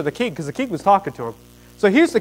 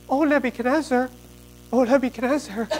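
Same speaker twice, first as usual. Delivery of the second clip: softer, higher-pitched mock appeal to the king; tattling courtiers; 7 semitones higher, 4 dB quieter than usual.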